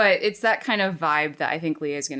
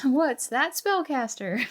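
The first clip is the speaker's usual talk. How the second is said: Pokemon narrator voice; 8 semitones higher, slower than usual. Pretend. Continues the joke, laughing.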